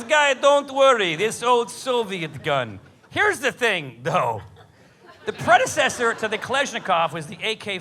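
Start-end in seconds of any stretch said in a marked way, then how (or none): 0.0-2.8 mock Russian accent. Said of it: "He goes".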